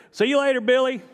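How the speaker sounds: high, bright call of goodbye; cheerful farewell. Retold or imitated. Pastor parting from a new friend, high cheerful goodbye. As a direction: call it out friendly as you part. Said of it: "and I said".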